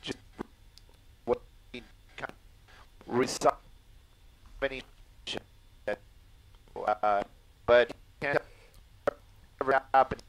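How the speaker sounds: audio cutting in and out; broken-up call audio, words dropping out; choppy voice. Real, not acted. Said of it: "moment of speaking got chopped up".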